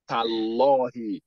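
Tallahi drawn out as "tal-LAW-hi"; slow Tallahi with a rounded, heavy middle; heavy Lam. Shown as a demonstration. Teacher modelling Lam after Fatha, heavy Lam. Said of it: "Anytime Dhamma or Fatha is coming".

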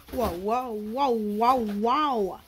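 ordinary delivery

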